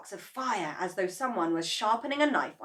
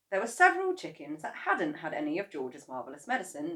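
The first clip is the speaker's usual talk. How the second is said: plain reading voice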